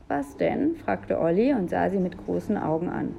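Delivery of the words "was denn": put-on high, childlike question; higher-pitched, curious child; curious little boy. A narrator's reading. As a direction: ask as a wide-eyed little brother. Curious little brother asks.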